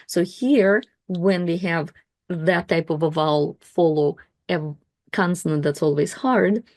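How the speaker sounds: ordinary talk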